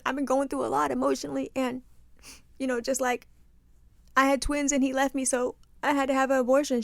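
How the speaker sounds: halting, lightly weepy retelling; imitated tearful girl. Tearful girl explaining her struggles on a reality show, mock tearful. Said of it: "one girl just starts crying".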